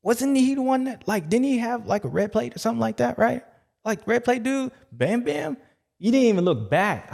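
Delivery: high mock confusion. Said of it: "I'm like".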